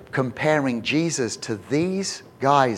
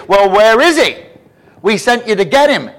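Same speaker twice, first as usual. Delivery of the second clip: loud, high demand for answers; indignant demand; 8 semitones higher, 10 dB louder than usual. Acted. Chief priests confront the empty-handed guards, loud and indignant. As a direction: demand it loudly, pitched well up.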